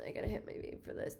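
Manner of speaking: breathy whisper to herself; whispered aside. Real, not acted. Whispering an aside to viewers before vaping. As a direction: mutter it under the breath as an aside. Aside while reaching for vape.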